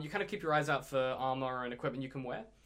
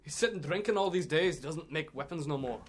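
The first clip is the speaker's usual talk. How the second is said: dwarf shop assistant; 2 semitones higher, 5 dB louder than usual. Acted. Shop assistant tells customers the smith retired, dwarf voice. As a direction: break the news as a rustic shopkeeper.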